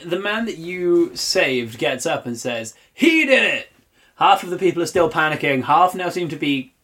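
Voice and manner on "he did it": high, loud accusation; accusing shout. Acted. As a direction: point and shout at him. Saved man shouts accusation.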